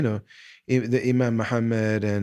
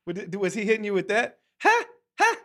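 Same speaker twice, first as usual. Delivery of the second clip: mock lecturing; 8 semitones higher, faster than usual. Host imitating Gregory's lecture.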